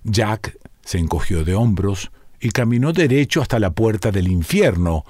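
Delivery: ordinary delivery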